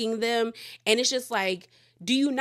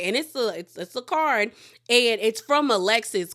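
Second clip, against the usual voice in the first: ordinary delivery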